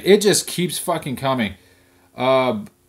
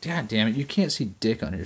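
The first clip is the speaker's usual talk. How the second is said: ordinary talk, nothing marked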